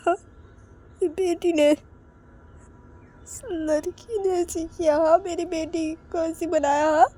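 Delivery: high, tearful wail; acted crying. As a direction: wail the blame through mock sobs. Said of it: "रोते रोते बोलती". (रोते-बिलखते हुए, ऊँची आवाज़ में)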